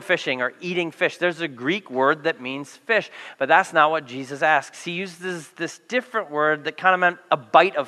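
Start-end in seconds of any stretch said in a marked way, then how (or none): none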